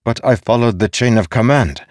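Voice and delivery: heated self-defence; growing anger. A narrator's reading. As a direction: push back with rising temper. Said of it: "growing even more angry".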